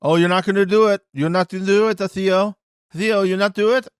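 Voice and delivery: high-pitched goading taunt; Turbo. Retold or imitated. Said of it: "by basically being like".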